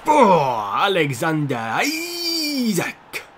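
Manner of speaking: excited. Real, not acted. Celebrating a goal in game.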